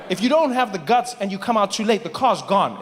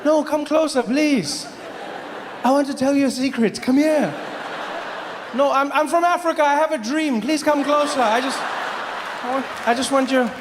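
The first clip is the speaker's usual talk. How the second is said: pleading New Yorker; 4 semitones higher than usual. Retold or imitated. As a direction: beg the too-far car to come closer. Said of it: "It's just like".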